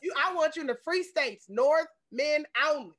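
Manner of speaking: high, loud, emphatic announcement; loud proclamation. Real, not acted. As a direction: declare it loudly, slowing at the end. Mock proclamation to possible suitors.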